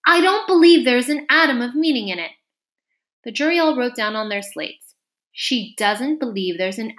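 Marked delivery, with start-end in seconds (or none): 0.1-2.3 higher, louder outspoken objection; bold assertion. A narrator's reading. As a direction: object firmly and openly. Alice boldly interrupts the court.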